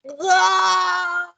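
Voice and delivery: high, loud football goal cry; goal shout. Shown as a demonstration. Teacher showing students her goal yell. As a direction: yell it like a fan in the stands. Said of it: "¿gritaste o no gritaste?".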